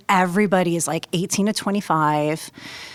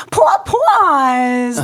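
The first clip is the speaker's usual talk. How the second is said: loud, high New York vowels on "poor paws"; New York accent; 6 semitones higher, 6 dB louder than usual. Retold or imitated. Student reading word drill to classmates, exaggerated New York accent. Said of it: "making fun of my accent".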